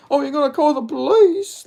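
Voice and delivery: mock him in a high sarcastic voice. The guy mocking Mike Perry, in a high sneering voice. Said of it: "mocked Mike Perry. Like that".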